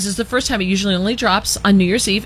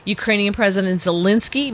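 usual speaking voice

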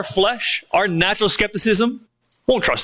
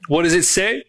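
usual speaking voice

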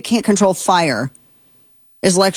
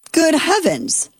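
high, slow, drawn-out disbelief; exasperated; 10 semitones higher, slower than usual. Real, not acted. Host exasperated at the smoke from Canada's fires. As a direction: sigh it out in exasperation.

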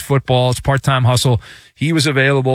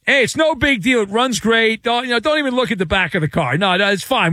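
higher-pitched, hurried brush-off; breezy car returner; 6 semitones higher than usual. Pretend. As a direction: play someone glossing over damage, pitch up. Acting renter returning damaged car.

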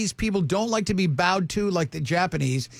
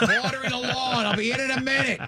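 higher-pitched dad calling toward the house; imitated dad; 6 semitones higher than usual. Dad answering from the lawn, imitated calling-out voice. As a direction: call out like a dad busy outside. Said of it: "Like my dad back in the day".

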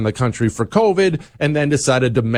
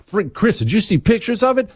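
excited; 10 semitones higher than usual. Retold or imitated. Imitating a friend's excited reaction.

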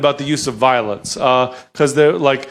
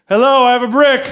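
higher and louder, brightly polite greeting; polite rioter; 10 semitones higher, 4 dB louder than usual. Retold or imitated. To a shop, cheery polite rioter with a brick. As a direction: announce the brick like a cheery customer.

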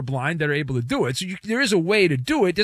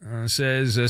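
normal talking voice

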